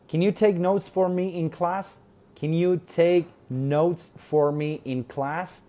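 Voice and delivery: Can you take notes read slowly, rising at the end; half speed for repeating; slow model question. Shown as a demonstration. Teacher drilling Lily's request slowly. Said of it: "slow model reading".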